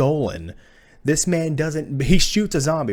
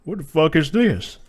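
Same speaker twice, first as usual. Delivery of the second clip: higher, slow mocking dopey voice; drawn-out mock bewilderment, pitched up; Wesker; 4 semitones higher than usual. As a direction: imitate him as an uncool, baffled guy. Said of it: "You know what he says?".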